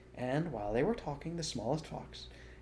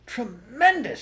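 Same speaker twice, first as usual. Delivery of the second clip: higher, loud triumphant shout; 13 semitones higher, 12 dB louder, slower than usual. A narrator's reading. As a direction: cheer out the word. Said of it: "shouted Badger".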